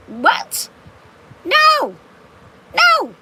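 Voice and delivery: high, loud cry of disbelief; shocked denial. Retold or imitated. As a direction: shriek no, no in outrage at the lie. Shocked at a fake Facebook post.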